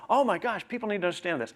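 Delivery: high, gushing exclamation; excited gushing. Retold or imitated. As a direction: gush with wide-eyed awe. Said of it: "very passionate".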